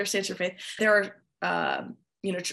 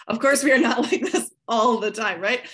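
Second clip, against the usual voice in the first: laughing through the words; 3 semitones higher, 7 dB louder than usual. Real, not acted. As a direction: chuckle while saying it. Laughs after sarcastic bit.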